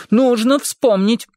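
an overdone dramatic plea; theatrical exclaiming. A narrator's reading. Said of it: "театрально воскликнул он".

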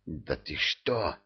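slow, soft hiss; hissing vampire. Acted. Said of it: "прошипел вампир".

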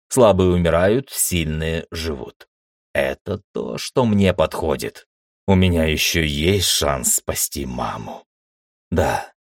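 plain reading voice

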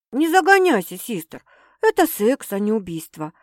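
ordinary delivery

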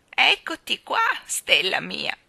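put-on loud, slow, quavering old balloon seller; trembling old woman. Balloon woman chides the children, in a loud trembling old voice. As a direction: scold them like a frail old woman full of passion.

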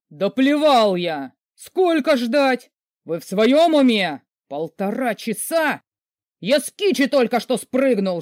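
громкий медленный яростный ор в телефон; орать на диспетчера, растягивая слова; яростный крик. Acted. Великан орёт на диспетчера такси. (великан орёт в трубку на службу такси)

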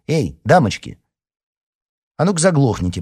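high, loud call to order; sharp reprimand. A narrator's reading. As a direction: call out loudly to silence them. Editor orders noisy girls quiet.

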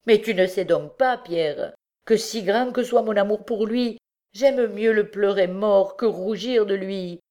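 high-pitched maternal protest, urgent and incredulous; indignant outcry. A narrator's reading. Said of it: "s'écria Miette". (protestation indignée et bouleversée)

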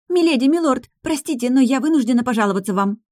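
put-on high, breathless young maid. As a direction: rush it out like a flustered maid. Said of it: "запыхавшаяся Лара".

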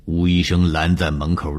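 plain reading voice

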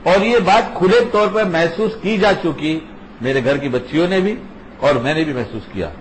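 ordinary delivery

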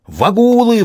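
high-pitched alarmed yell; sudden yell. A narrator's reading. Soldier spots enemies and fires, sudden yell. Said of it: "вдруг заорал Прошка Пономарёв".

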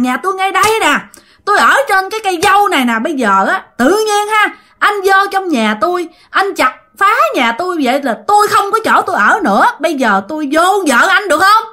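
high, aggrieved female ghost voice; ghost woman scolding. Acted. Ghost keeps scolding the man over the felled mulberry tree, high voice. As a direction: scold on insistently, pitched high. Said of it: "không biết trả lời bà làm sao thì bà lại chửi tiếp".

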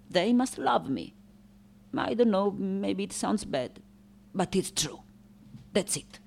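soft, tentative admission; hushed, sincere pleading; vulnerable confession. Acted. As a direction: confide it quietly, a little shyly. Artist confides wish for love.

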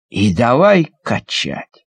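usual speaking voice

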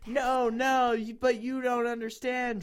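high, insistent plea; hammed-up begging to be understood; mock pleading. A narrator's reading. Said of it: "Draco pleaded".

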